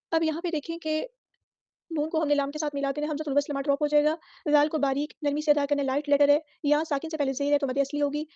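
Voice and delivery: normal talking voice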